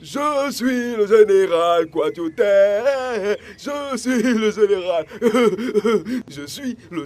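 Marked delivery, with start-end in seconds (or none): 0.0-5.1 slow pompous. Acted. Général se vante lentement.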